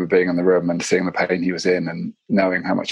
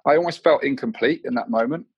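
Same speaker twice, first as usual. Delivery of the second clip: ordinary talk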